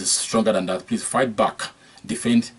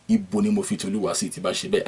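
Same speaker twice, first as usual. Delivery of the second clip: ordinary delivery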